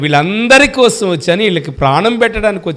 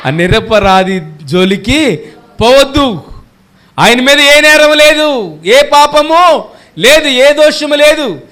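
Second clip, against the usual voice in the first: slow, higher and louder declaration; loud proclamation; 6 semitones higher, 4 dB louder, slower than usual. Acted. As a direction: proclaim it slowly, full voice. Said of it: "గట్టిగా చెప్పండి".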